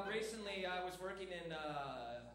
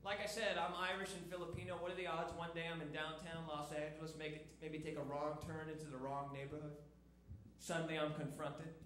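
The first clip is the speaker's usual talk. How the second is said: normal talking voice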